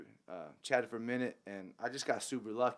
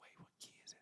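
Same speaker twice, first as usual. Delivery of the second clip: whispered question; 23 dB quieter, much faster than usual. Retold or imitated. Secretly asking the keys player.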